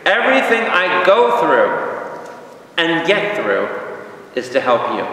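high, slow, ringing scripture quote, settling at the end; emphatic verse proclamation. Shown as a demonstration. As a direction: proclaim it to the congregation. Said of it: "Push the repeat button".

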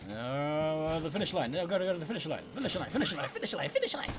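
high, frantic chanting that climbs; over-excited. Real, not acted. Player racing for the finish line, over-excited. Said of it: "I was a bit too excited there".

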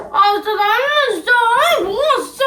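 whining, rising into a wail; tearful frustration. Real, not acted. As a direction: moan helplessly, close to tears. Brother upset over eaten noodles. (속상해서 울먹이기)

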